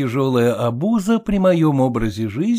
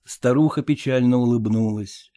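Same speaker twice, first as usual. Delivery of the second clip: usual speaking voice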